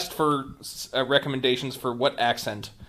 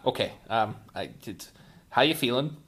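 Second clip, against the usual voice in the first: ordinary talk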